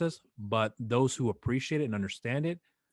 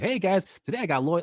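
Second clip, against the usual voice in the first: higher, louder cheesy host intro; mock podcast intro; 9 semitones higher, 5 dB louder than usual. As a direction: parody a generic show opener. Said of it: "but I don't do like".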